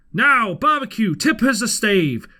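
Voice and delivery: loud, high sailor's call. A narrator's reading. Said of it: "cried one voice".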